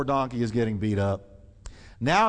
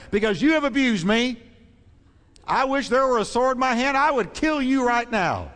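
raised, furious voice, wishing for a sword; furious Balaam; 9 semitones higher, 3 dB louder than usual. Acted. Balaam shouting at his donkey, furious. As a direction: rage at the donkey, high and loud. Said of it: "Look what he said".

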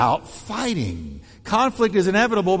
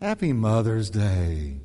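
flat greeting; 8 semitones lower, slower than usual. Shown as a demonstration. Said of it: "with the wrong tone".

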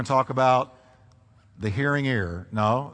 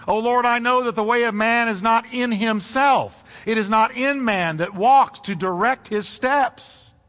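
raised, ringing preacher's reading; preaching scripture; 7 semitones higher, 4 dB louder than usual. Acted. Preacher reads Jeremiah aloud, full preaching voice. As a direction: proclaim the verse to the congregation.

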